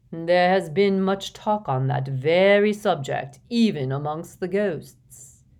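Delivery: slow, stately ghost with emphatic loud swells; drawn-out, ceremonious interjection; pompous ghost gentleman. Acted. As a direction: hold forth self-importantly, stretching the words. Voices Nearly Headless Nick.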